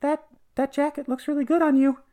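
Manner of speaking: same high little peanut voice; tiny little voice. Acted. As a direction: chirp the compliment in a tiny high voice. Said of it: "he hears that same weird voice".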